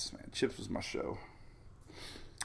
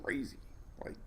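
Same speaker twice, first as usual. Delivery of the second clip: breathy hushed whisper; 13 dB quieter than usual. Real, not acted. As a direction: drop to a whisper in awe. Awed about hot water anomalies.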